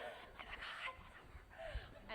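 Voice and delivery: awed whispered gasp at a celebrity; starstruck whisper. Retold or imitated. Spotting a celebrity, awed whisper. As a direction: breathe it out, starstruck. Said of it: "you act like a spaz".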